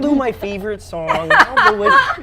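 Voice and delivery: high froggy puppet voice, chuckling; cheerful Kermit the Frog impression. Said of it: "You need to sing Kermit".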